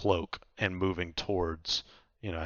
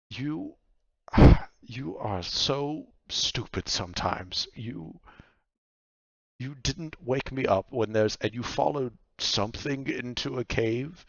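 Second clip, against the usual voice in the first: exasperated scolding with a big sigh; higher-pitched, fed-up woman; exasperated Vaku; 5 semitones higher, 5 dB louder than usual. Acted. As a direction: scold him in disbelief, breaking off mid-thought.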